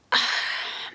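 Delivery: short, heavy sigh; audible sigh. Real, not acted. Vlogger weighing up her old audition choice, audible sigh. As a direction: let out a sigh before answering.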